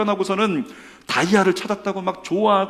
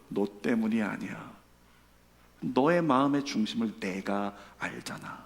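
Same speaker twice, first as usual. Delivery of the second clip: God's gentle answer; 6 semitones lower, 8 dB quieter than usual. Acted.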